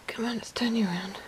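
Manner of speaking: whispering gently to a pet; hushed coaxing whisper; soft whisper. Real, not acted. Coaxing the guinea pig gently.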